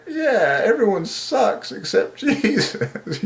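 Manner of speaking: high, overwhelmed voice breaking into laughter; close to crying. Real, not acted. Confessing everyone falls short except Jesus, tearfully laughing. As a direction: laugh through it as if about to cry.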